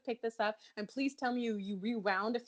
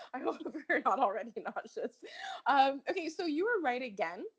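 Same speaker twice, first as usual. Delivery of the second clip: ordinary talk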